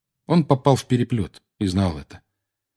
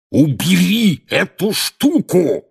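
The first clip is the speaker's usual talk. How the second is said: slow, high furious roar; 10 semitones higher, 5 dB louder, much slower than usual. A narrator's reading. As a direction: roar it at his ear, much higher and louder. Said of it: "проревел дядя Вернон".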